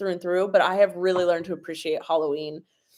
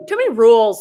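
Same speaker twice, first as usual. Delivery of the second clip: exasperated outburst; 10 dB louder, faster than usual. Real, not acted. Venting about beauty rules.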